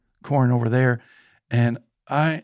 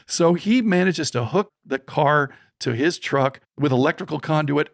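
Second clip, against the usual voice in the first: usual speaking voice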